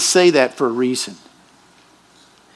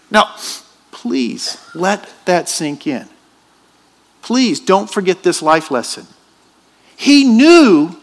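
normal talking voice

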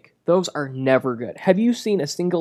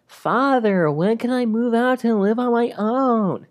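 put-on high, drawn-out puppet-boy voice; exaggerated put-on character whine; Pauly Shore Pinocchio; 8 semitones higher, slower than usual. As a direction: do a hammed-up Pinocchio, pitched way up. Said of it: "the Pauly Shore Pinocchio".

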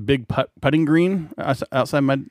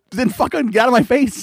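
mock outburst; 11 semitones higher, 3 dB louder than usual. Retold imagined outburst.

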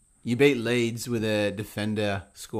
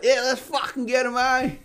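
put-on high, childish play-along; mock kid voice; 13 semitones higher, 4 dB louder than usual. Playing along with the kid in a high mock voice. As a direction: egg the kid on in his own squeaky voice. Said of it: "I'm just like".

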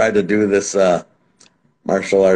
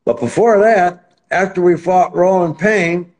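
excited; 9 semitones higher than usual. Real, not acted. Excited retelling of fight.

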